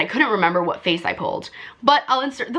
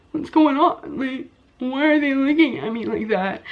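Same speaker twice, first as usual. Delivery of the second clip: higher, slightly whimpered question; a touch of mock crying; mild tearful confusion; 6 semitones higher than usual. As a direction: re-enact a bewildered, weepy question. Retells tearful confusion at friends.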